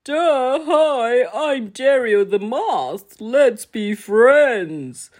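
high, slow goofy moth; dopey moth voice. Acted. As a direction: stretch it out dim-witted and friendly. Moth character voice.

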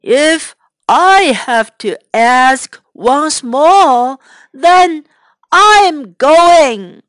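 loud, slow warning building up; angry threat. Acted. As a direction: lay down the final warning. Angry farmer threatens to leave.

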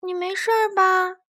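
high-voiced concern for a friend; worried hedgehog. A narrator's reading. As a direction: ask anxiously in a little hedgehog's voice. Hedgehog checks on fallen mole.

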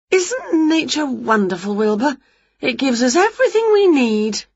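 high, happy marvelling; pleased, lilting storybook witch; gushing wonder. A narrator's reading. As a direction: gush about it in a high witch voice. Winnie gushes about nature.

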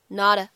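"not a" linked with a flapped T, like "nodda"; American flap in "not a". Shown as a demonstration. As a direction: tap the T in "not a" American-style. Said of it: "典型的美音的闪音的处理".

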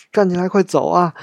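high, loud retold yell; a loud urgent yell, retelling a stranger; people yelling. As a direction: shout at the crowd to get moving. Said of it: "然后就开始让人嚷".

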